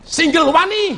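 high, loud bravado; played-up fighting boast; acted bold challenge. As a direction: call it out as a dare. Acts out bold challenge to questioner.

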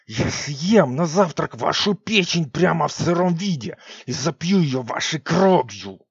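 slow menacing witch hiss. Acted. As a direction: hiss the threat slowly at the guards. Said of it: "прошипела Сикс".

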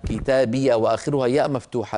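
usual speaking voice

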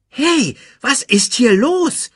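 high, loud, baffled question; baffled demand. A narrator's reading. Timon watches lions celebrate, baffled high demand. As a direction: burst in, wanting an explanation. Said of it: "fragte Timon".

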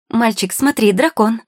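high, loud, excited call; urgent look-there shout; loud exclamation. A narrator's reading. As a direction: cry out to divert a child's attention. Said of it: "громко воскликнула Маргарита".

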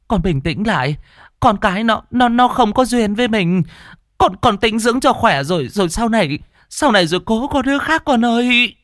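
high, tearful urgent comforting; pleading old mother. Acted. Mother-in-law pleading with the frantic wife, tearful. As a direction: beg her to calm down.

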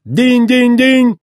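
high, slow, loud ding-ding-ding; sing-song high bell chimes; imitated bell ding. A narrator's reading. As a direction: ring out like a pump bell. Imitating the fuel pump bell.

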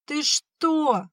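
put-on very high boyish outcry; shocked exclamation. A narrator's reading. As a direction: cry out in protest, pitch leaping up. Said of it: "воскликнул Пашка". (потрясённый возглас)

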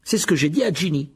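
loud, high, fierce retort; ton féroce. Acted. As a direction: fire back fiercely. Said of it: "dit Percy d'un ton féroce". (réplique féroce et forte)

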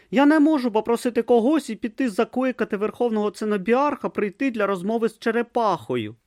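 put-on high, earnest young novice voice; a naive boy talking to a turtle; young novice Brutha. A narrator's reading. Young novice offers the tortoise lettuce, high and earnest.

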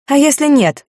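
high, loud defiant retort; defiant challenge. A narrator's reading. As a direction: throw the challenge back.

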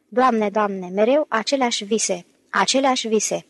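plain reading voice

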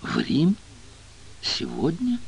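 shocked, soft, rising questions; a small stunned squeak; stunned stammer. A narrator's reading. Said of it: "пролепетала потрясённая Дэйна".

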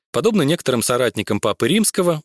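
ordinary delivery, nothing marked

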